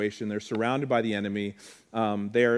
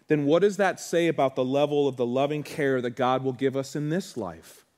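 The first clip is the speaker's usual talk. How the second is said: normal talking voice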